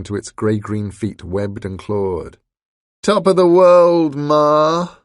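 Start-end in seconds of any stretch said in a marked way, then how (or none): 3.0-5.0 high, loud monstrous howl. A narrator's reading. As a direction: howl it up to the sky. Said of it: "it howled".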